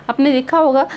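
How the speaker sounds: normal talking voice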